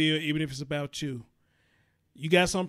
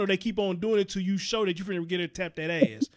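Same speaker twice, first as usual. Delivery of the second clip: ordinary delivery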